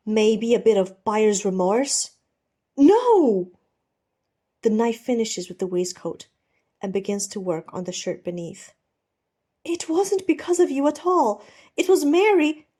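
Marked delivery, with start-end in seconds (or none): 0.1-2.1 louder sneering jab; bitter taunt. Acted. 2.8-3.5 high, loud protest; desperate shout. Acted. 9.7-12.7 high, loud pleading; desperate shouting. Acted. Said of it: "embarrassed at himself for shouting".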